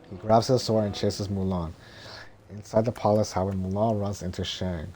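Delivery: ordinary delivery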